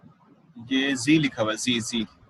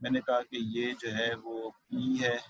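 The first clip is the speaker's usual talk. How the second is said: normal talking voice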